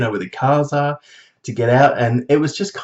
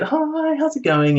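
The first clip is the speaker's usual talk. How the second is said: much higher, bright mock hello; a light imitation of a cheery shop assistant; chirpy shop greeting; slower than usual.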